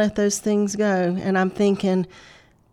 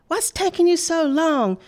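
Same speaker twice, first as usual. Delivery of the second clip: put-on impatient little girl; 9 semitones higher, 5 dB louder than usual. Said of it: "the other sister came in and said".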